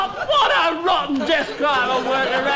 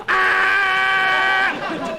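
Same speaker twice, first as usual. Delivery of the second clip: ant mating call shout; 5 semitones higher than usual. Pretend. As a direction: yell it out as a tiny insect call. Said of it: "magnified a thousand times".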